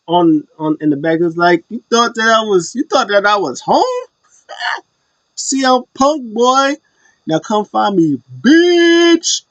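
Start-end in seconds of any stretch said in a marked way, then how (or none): 1.7-9.4 high-pitched, showy wrestler's challenge; hammed-up gloating call-out; mock taunt. Acting out Punk's taunt to Drew.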